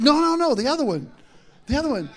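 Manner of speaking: mock panic at the drive-thru; frantic correction. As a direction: plead in a high panicked voice.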